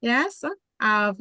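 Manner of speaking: normal talking voice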